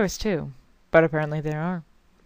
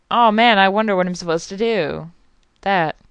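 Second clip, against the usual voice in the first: normal talking voice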